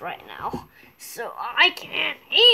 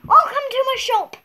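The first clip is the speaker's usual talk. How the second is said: high, loud, quick shop welcome; shopkeeper greeting; 5 semitones higher, 3 dB louder than usual. Pretend. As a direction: greet the customer loudly. Child plays shopkeeper.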